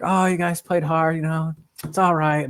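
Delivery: mock soothing grandma. Grandma consoling grandson after a lost game, mock soothing. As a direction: pat the losing team on the head, gently. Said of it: "Grandma's like".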